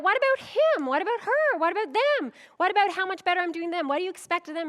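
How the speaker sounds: loud, high petty whining; whiny complaint. Retold or imitated. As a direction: complain about everyone else. Petty comparing, acted out.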